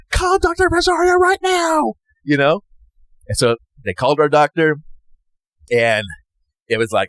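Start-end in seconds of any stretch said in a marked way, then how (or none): 0.1-1.9 yelled order. Retold or imitated. Nurse yelling for the doctor, urgent shout. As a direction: yell it down the hall for the doctor. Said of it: "and yells".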